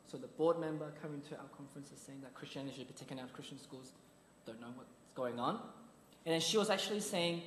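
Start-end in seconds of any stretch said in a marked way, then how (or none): 0.1-5.5 quiet realisation. Retold or imitated. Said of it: "I was like".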